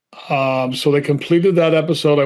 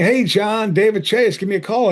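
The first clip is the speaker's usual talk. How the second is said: bright mock-cheerful imitation, pitched higher; upbeat voice; 6 semitones higher than usual. Actor imitating the showrunner's upbeat voicemail, pitched higher. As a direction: imitate a chipper phone message. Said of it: "I'm analyzing his delivery".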